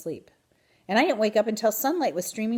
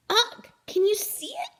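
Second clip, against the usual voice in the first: high whispery hamster squeak; breathless little squeak to the frog; excited squeak. A narrator's reading. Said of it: "I squeaked".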